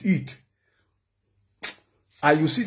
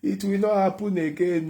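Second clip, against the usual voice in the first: pleading promise; 7 semitones higher than usual. Retold or imitated. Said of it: "you not go".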